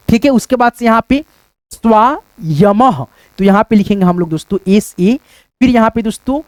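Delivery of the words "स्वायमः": स्वायमः stretched very slowly, ending in a clear h; very slow teacher's स्वायमः; drawn-out demo. Shown as a demonstration. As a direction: model स्वायमः syllable by syllable with a breathy ह. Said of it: "विसर्ग का उच्चारण ह के रूप में".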